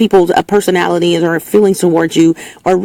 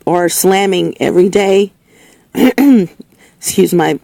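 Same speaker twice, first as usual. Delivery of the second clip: ordinary delivery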